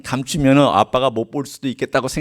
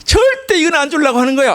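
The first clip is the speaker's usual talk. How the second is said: put-on stubborn child; 13 semitones higher, 3 dB louder than usual. Preacher voicing stubborn child.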